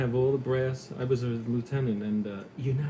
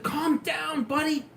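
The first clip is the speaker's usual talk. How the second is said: wary warning; 3 dB louder, slower than usual. Retold or imitated. Retold reply to feared driver.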